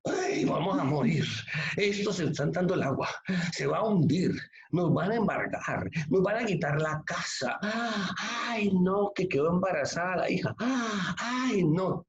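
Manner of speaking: hammed-up wailing panic, high; mock panic. Preacher mimics panicked believers on the sinking boat, mock wailing. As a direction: play the crowd crying out in fear.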